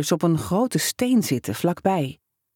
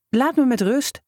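high, tearful, voice cracking; voice breaking; 7 semitones higher than usual. A narrator's reading. Hurt boy telling the prankster to leave him alone, voice breaking. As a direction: hold back tears as it cracks. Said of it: "Zijn stem brak".

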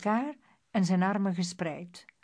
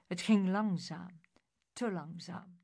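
ordinary delivery, nothing marked

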